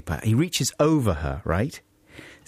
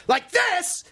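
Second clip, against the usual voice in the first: sudden shout. Shown as a demonstration. Demonstrating the shout that blows the car windows out. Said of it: "one of you shouts".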